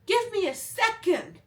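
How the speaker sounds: very high, loud flustered cry; exasperated plea. Retold or imitated. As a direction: re-enact losing patience under pressure. Acted exasperated reply under pressure.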